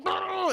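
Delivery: high imitated buzz; electric shock noise. Al Leon shocks him, imitated high buzzing. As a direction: buzz like a jolt of current.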